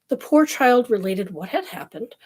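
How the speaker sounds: ordinary delivery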